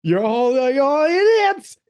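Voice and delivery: high mock rant at idiots; Pacino ranting. Mocking Pacino yelling at the union men. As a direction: mimic Pacino berating them, climbing high. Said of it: "It's like".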